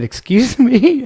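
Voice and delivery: surprised. Retold or imitated. Wife puzzled by stranger's compliment.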